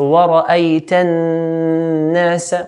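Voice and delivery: slow wara'ayta nasa with a long hummed n between; held noon. Shown as a demonstration. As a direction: hold the n into nasa, then release. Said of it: "So hold the noon".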